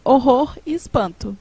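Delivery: ordinary talk, nothing marked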